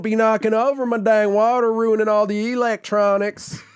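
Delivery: high, twangy Southern holler; high-volume Southern accent. Pretend. Co-host warning about the water, in a high Southern accent. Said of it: "This is a very high volume accent".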